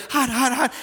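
put-on high little-boy cry of hot; toddler complaining. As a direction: play the child in the hot tub. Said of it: "he said".

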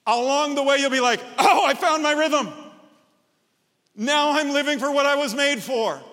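loud, high fired-up sermon delivery; rally the congregation, near shouting; full-force preaching. Acted. Pastor urging the church to serve, passionate shout.

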